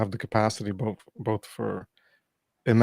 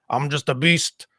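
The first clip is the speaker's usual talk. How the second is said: mock beast; 4 semitones higher than usual. Mock primal meat-eater persona.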